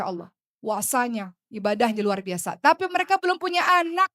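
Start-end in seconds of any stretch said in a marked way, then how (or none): 2.6-4.1 higher, louder emphatic point; preacher stressing the point, raised voice. Real, not acted. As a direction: drive the point home.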